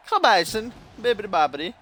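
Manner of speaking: hammed-up, high-pitched mock Italian; Italian accent. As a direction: play up a singsong Italian accent. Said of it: "said that in an Italian accent".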